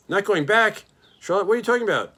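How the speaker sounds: high, loud alarmed cry; shocked disbelief. A narrator's reading. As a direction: leap up in disbelief. Shocked pig leaps up.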